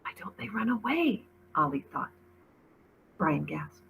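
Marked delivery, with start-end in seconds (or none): 0.0-1.2 breathy frightened whisper; barely a breath, hiding from danger. A narrator's reading. Frightened thought while hiding.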